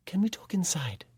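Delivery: hushed whisper. A narrator's reading. Ellie asks Tom at his door to talk inside, whispering. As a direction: whisper so the baby stays asleep. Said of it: "a voice called my name softly".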